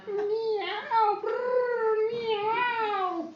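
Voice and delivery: high, happy cat meow and purr. Acted. Cat noises in story.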